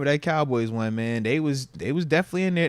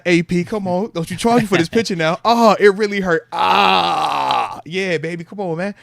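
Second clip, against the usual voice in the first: mock pleading; 4 semitones higher, 6 dB louder, slower than usual. Replays clowning for the photographer.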